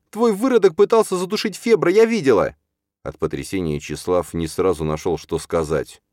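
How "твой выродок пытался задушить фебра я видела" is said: loud, high-pitched outcry; hysterical accusation. A narrator's reading. Stepmother denouncing the boy to his father, hysterical. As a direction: wail it at full pitch.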